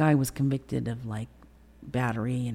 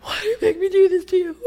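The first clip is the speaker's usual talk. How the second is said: very high, loud imitated wail; hammed-up tearful whine; mock crying; 8 dB louder than usual. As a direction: mimic a boyfriend blubbering in self-pity. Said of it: "And he starts crying".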